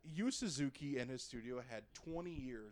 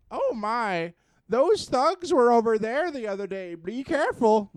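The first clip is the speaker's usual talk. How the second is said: louder, higher fussy villager; 7 semitones higher, 14 dB louder than usual. Retold or imitated. Villager warns the hero, higher and louder. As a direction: warn him like a fussy old local.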